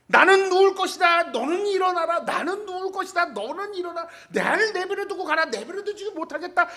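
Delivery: put-on high, old-fashioned solemn speech; mock solemn declaration. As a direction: playact a stubborn standoff. Mock-solemn dialogue of Jacob and God.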